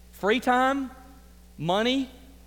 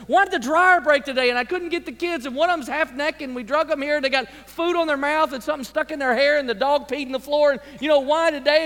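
louder, higher, harried rant; frazzled parent; 6 semitones higher, 4 dB louder than usual. Retold or imitated. Parent ranting about a chaotic morning, louder and frazzled. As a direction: pour out the Sunday-morning chaos in a rush.

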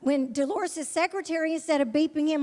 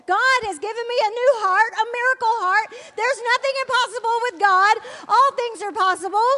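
higher and louder, exultant mother; joyful proclaiming; 7 semitones higher, 6 dB louder than usual. Retold or imitated. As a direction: announce the miracle with triumphant joy. Mother joyfully announcing healed heart.

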